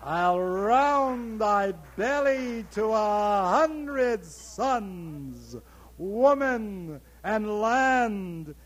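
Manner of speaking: loud, slow, soaring declamation; booming climax. Acted. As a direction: roar the vision to the sky. Monologue climax, booming lines.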